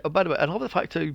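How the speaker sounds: normal talking voice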